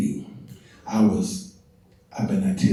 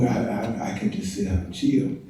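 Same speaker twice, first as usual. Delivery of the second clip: normal talking voice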